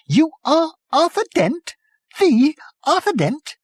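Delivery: very high, astonished voice; amazed. Acted. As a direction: gasp in disbelief. Prack amazed, laughing.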